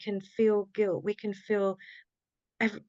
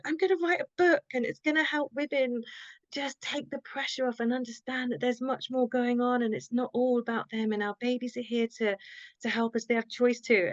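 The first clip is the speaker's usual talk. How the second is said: much higher, bursting with eager joy; excited announcement; 4 semitones higher than usual. Retold or imitated. Telling her husband about the book, high and excited. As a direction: gush it out like thrilling news. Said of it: "running back to my husband and going".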